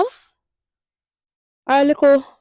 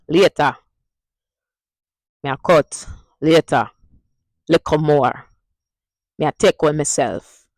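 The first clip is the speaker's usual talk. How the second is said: Jamaican Patois sentence; 4 dB louder than usual. Shown as a demonstration. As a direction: demo line in Jamaican Patois. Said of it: "Say it with me now".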